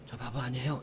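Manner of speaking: very soft, half-asleep protest; sleepy reply. Retold or imitated. Pastor replying to teasing while half asleep, very soft. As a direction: mumble it back from sleep. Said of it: "자다가도".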